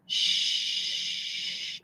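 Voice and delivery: long, soft, voiceless "shh"; soft stretched shh. Shown as a demonstration. Teacher contrasting SH with CH, a soft drawn-out shh. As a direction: stretch the "shh" out on one breath. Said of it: "you can stretch out the S H".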